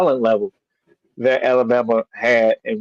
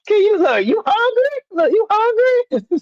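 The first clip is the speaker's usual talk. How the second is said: mock hospitable host. Mimicking the host offering food.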